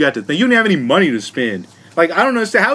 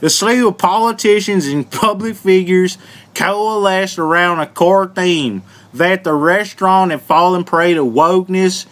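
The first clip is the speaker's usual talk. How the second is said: put-on real Southern accent. Host reads a Cracker Barrel article, hammed-up Southern accent. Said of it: "read this whole article in this voice".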